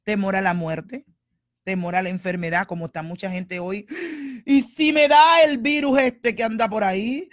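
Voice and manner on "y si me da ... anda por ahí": higher, much louder panicked question; loud hammed-up virus panic; mock panicked worry. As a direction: play the frightened churchgoer in alarm. Voices people fearing the virus.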